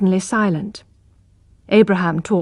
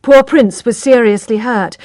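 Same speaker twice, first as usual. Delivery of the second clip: ordinary delivery, nothing marked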